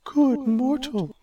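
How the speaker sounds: high, slow goddess greeting; Aphrodite, gracious. Acted. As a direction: greet him sweetly. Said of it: "Aphrodite said".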